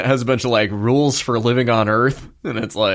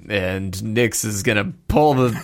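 ordinary delivery

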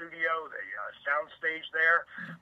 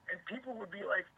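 usual speaking voice

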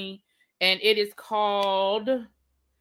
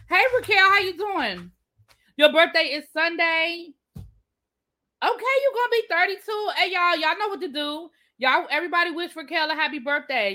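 birthday shout-out; 7 semitones higher than usual. Real, not acted.

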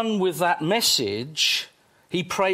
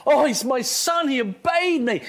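higher-pitched, delighted boasting about a son; proud father; 5 semitones higher than usual. Acted. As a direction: exclaim it like a bursting-proud father. Voicing the delighted Father praising his Son.

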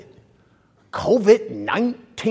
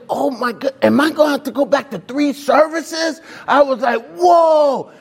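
high, rising alarmed exclamations; mock panic at too many people; retold alarm; 10 semitones higher than usual. Pastor picturing a packed church, mock alarmed.

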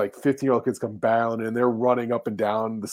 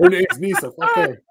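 high, laughing joke; 5 dB louder than usual. Real, not acted. Joking about the wife among friends, laughing high. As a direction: squeal it through laughter.

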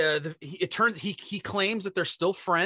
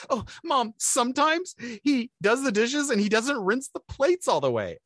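whining daughter; 6 semitones higher than usual. Retold or imitated. Daughter complains again.

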